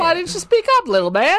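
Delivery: very high, loud character voice; mock bartender. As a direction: ham up a bartender chiding the kid. Plays bar staff answering the kid.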